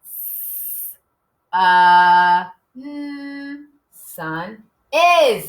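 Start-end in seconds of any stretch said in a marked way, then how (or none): 0.0-3.6 "S-, uh, mm" as separate held sounds; sounding out. Shown as a demonstration. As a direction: hiss the S-, then uh, then a hummed mm. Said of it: "help me sound out sun".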